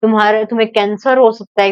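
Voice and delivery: ordinary delivery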